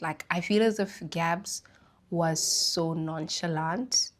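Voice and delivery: ordinary talk, nothing marked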